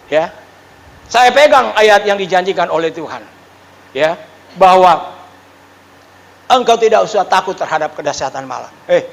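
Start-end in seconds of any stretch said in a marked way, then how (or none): none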